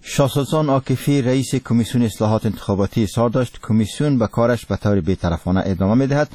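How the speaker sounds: normal talking voice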